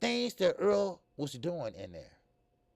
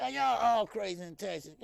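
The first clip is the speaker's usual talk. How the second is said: mock question; 6 semitones higher than usual. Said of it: "Just ask me that".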